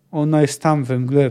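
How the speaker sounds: ordinary delivery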